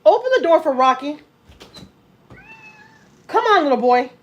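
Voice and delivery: loud call to the dog; calling out loudly. Real, not acted. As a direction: shout for the dog from across the room.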